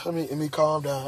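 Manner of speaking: low, soft words to himself, sighing; quiet self-talk. Retold or imitated. Man calming himself down after finding his door opened, quietly. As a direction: talk yourself down, softly.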